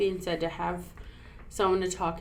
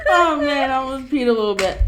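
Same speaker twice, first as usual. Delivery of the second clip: laughing; 7 semitones higher, 12 dB louder than usual. Real, not acted. Still cracking up.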